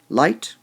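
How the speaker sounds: "Light" said loud and rising; stress on light. Shown as a demonstration. Teacher marks stressed syllables for students, hitting "Light" loud. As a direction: punch the word "Light". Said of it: "Light is a strong word".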